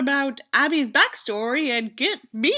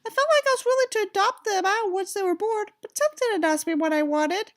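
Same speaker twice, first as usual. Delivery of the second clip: Abby's letter voice; 12 semitones higher than usual. Acted. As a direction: read it as the hurting girl herself. Reads Abby's letter in her voice.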